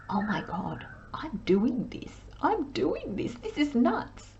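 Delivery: soft, high-pitched imitation of nervous pride; hushed giddy disbelief. Reader voices a client amazed at themselves, hushed and giddy. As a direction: act out a quiet, thrilled disbelief. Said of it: "nervous but quite proud of yourself".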